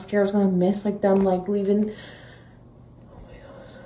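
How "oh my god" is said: whispered wonder; hushed real whisper; awed whisper. Real, not acted. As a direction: breathe it out in awe.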